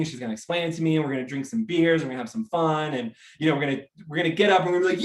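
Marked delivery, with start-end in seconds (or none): none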